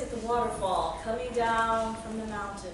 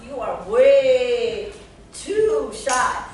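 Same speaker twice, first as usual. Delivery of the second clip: slow, loud storybook woodpecker making a point; emphatic, drawn-out telling-off; 7 dB louder, much slower than usual. Acted. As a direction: stretch the words out slowly and loudly. Woodpecker scolds timid fox.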